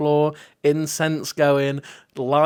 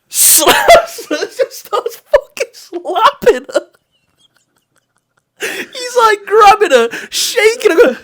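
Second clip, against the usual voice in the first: breaking into high laughter mid-sentence; can barely get the words out for laughing; laughing hard; 7 dB louder, slower than usual. Real, not acted. Telling a friend about the boat panic, laughing hard. Retelling slapstick chaos, cracking up.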